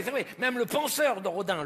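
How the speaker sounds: ordinary talk, nothing marked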